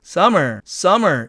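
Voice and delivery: "summer" as suh-mer, one m; "summer" with the first m dropped; correct pronunciation. Shown as a demonstration. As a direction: slowly model "summer" without a doubled m. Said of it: "이렇게 발음돼야 맞겠죠".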